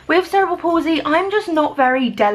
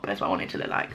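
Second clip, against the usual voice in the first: whispered aside; 9 dB quieter, much faster than usual. Real, not acted. Confiding aside.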